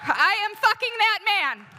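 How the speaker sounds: very high, very loud furious scream; yelled threat. Retold or imitated. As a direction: scream it at full force at the husband. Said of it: "I yelled at him".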